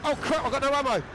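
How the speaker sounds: panicking gamer. Real, not acted. Out of ammo mid-fight.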